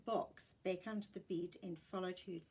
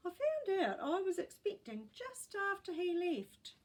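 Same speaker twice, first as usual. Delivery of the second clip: put-on old woman voice; 10 semitones higher, 4 dB louder than usual. A narrator's reading. Great gran voiced in story.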